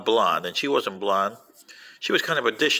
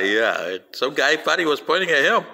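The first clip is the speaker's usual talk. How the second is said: ordinary talk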